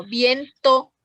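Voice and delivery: "viento" as vien, then to; two-beat "viento"; split into syllables. Shown as a demonstration. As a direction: set off the last syllable of "viento". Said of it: "de la última".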